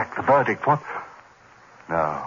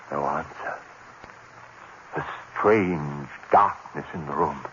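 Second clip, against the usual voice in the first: ordinary delivery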